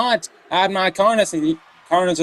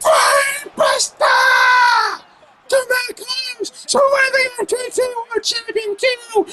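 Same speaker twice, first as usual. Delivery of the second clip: high, screaming hyped commentator; excited shout; slower than usual. Real, not acted. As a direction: yell it at the top of your voice. Near fall commentary.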